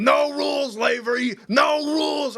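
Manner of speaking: high, loud frenzied chant; mock battle yell. Boss on his back yelling, high frenzied battle cry. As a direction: yell it like a lunatic attacker.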